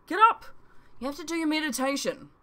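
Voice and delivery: high, loud order to get up; bossy wake-up shout; loud wake-up call. A narrator's reading. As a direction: shout him awake. Charlie wakes narrator loudly.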